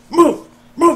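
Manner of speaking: sudden high loud command; barked order. Acted. Sergeant shouting at the troops, loud high command. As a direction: yell it like a drill sergeant. Mock army scene, ordering move.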